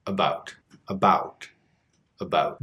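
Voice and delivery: "about" low, with a small relaxed ow vowel; relaxed Western Canadian about. Shown as a demonstration. Teacher demos the relaxed Western Canadian "about". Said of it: "The Western Canadian version is a little more relaxed".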